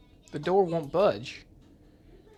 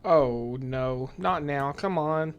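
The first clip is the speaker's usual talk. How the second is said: usual speaking voice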